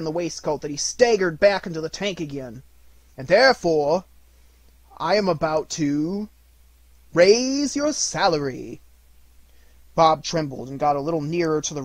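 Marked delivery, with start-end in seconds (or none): 3.2-8.8 louder, rising therefore then slow reveal; teasing build-up. A narrator's reading. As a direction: drag out the surprise gleefully. Scrooge springs surprise.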